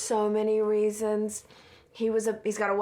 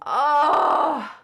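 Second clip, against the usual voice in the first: loud, high drawn-out "oh"; long, high moan of dismay; dismayed groan; 6 semitones higher, 7 dB louder than usual. Real, not acted. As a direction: wail it in dismay. Dismayed by film death.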